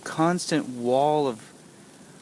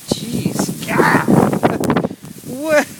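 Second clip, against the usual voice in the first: loud, high startled yelps with laughs; startled shouting; 9 semitones higher, 8 dB louder, much slower than usual. Real, not acted. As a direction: yelp and laugh as the snow hits. Caught in the blizzard outside.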